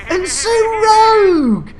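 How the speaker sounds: high, loud, drawn-out call of the name; excited shout. Real, not acted. Streamer spots a viewer in chat, loud excited call. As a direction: holler the name like an announcer.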